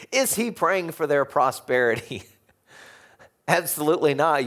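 ordinary talk

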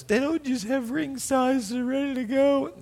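put-on girlfriend's voice. Retelling Sherry explaining rings, in a high mock voice. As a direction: squeak it out as her. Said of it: "She goes".

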